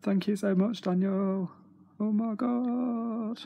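high, gushing thanks; thrilled and almost squealing; overjoyed thanks. Real, not acted. Fan thanking Daniel for the autograph, overjoyed gushing.

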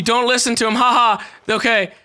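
usual speaking voice

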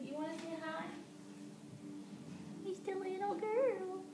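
high, soft, sing-song cooing; tiny doting voice, slowing down; cooing pet talk. Real, not acted. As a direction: coo sweetly to a little pet. Coos at pet Lucy.

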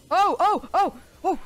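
sudden high loud yelps of fright; real jump-scare yelps; startled yelps. Real, not acted. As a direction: jolt out three scared cries.

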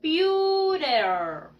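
"Computer" very slow, with a drawn-out final R; R-like sound. Shown as a demonstration. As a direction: stretch "Computer" for repeating, ending on a long R. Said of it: "como si fuera una R". ("Computer" muy lento, como con una R)

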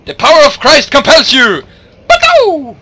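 loud, high preacher shout; exorcist. Pretend. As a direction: cast out the demon, shouting.

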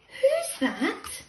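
high, drawn-out who's that; high suspense question. A narrator's reading. Narrator asking the children, high and curious. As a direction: ask it high with wide-eyed suspense.

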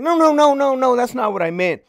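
high, loud backpedalling woman's voice; lieutenant backpedalling to the sergeant; mock flustered lady. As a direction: play her flustered. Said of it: "She's like".

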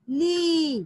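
ली with a long, held ee; long ee sound. Shown as a demonstration. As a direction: draw out ली clearly for the class. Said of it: "और उसका उच्चारण". (ली को लंबी ई से खींचकर)

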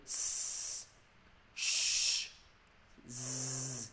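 isolated S, sh and z, each held as a long hiss; hissing consonant demo. Shown as a demonstration. Teacher shows the class the hissing sounds, drawn out. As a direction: sustain S, sh, z one by one. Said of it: "âm gió là gì".

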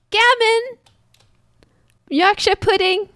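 high, playful luring call. Pretend. Player trying British foods to get his attention, high calling.

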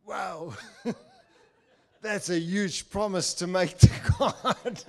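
amused disbelief, laughing; laughing thought. Retold or imitated. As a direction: laugh at the huge promise. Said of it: "And I'm thinking".

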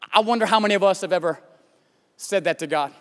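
normal talking voice

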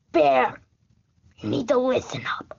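ordinary talk, nothing marked

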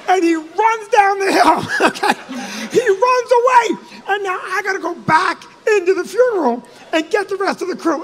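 loud, high-pitched, panicked storytelling; worked-up comic despair; frantic exasperation. Retold or imitated. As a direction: wail it as if abandoned in a crisis. Frantic telling of Barry fleeing.

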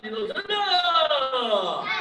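slow, drawn-out count call with a laugh; sharp martial arts commands. Real, not acted.

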